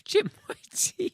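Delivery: very high, slow mocking whine; mock victim wail. Friend mocking the line back, very high mock whine. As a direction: parrot the line in a silly high voice.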